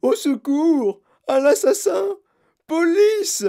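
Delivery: de plus en plus aigu, fort, paniqué; hurlé. Acted. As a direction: hurler au secours, affolée. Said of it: "hurla madame Gloop". (Mme Gloop hurle au secours, très aigu)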